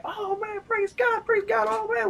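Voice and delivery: very high, squealed praise without shouting; mock ecstatic outburst, held back in volume; retold flipping out. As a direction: re-enact going crazy with joy, voice way up. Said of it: "We're just flipping out".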